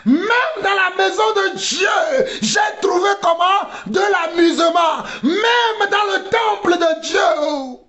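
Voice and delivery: high, drawn-out preacher's cry; slow, stretched shout from the pulpit; fiery rebuke at full force; impassioned preaching. Real, not acted.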